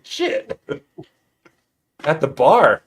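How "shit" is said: surprised curse. Retold or imitated. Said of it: "I'm like".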